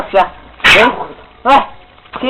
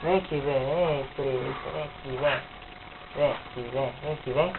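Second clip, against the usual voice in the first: much softer coaxing murmur; talking to a dog; 7 semitones lower, 18 dB quieter than usual. Real, not acted. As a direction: hush and coax the dog closer.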